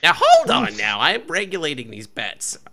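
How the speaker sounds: high-pitched bookie protest; halfling bookie butting in. Acted.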